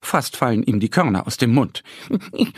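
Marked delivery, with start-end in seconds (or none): 2.3-2.5 sheepish little admission; small squirrel voice. A narrator's reading. Caught squirrel confesses its hunger, high timid voice. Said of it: "sagt es leise".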